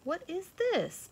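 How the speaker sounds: high, slow wondering fawn; curious fawn. A narrator's reading. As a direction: puzzle over it slowly. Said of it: "questioned the fawn".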